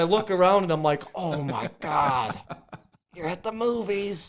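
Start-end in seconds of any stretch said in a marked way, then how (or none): 1.1-4.3 drawn-out, slow dismay; slow, mock-horrified reproach; appalled reaction. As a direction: imitate an aghast onlooker scolding. Imitates shocked moviegoers reacting.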